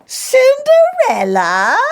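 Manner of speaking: high, slow scandalised rise; incredulous. A narrator's reading. Stepmother scorning Cinderella's turn, high shocked shriek. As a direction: shriek the name in disbelief. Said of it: "said Hyacinth".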